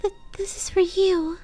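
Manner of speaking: high, timid stammering pony; high shy stutter offering a present. Acted. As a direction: hand over the gift nervously, stumbling. Shy Fluttershy gives gift.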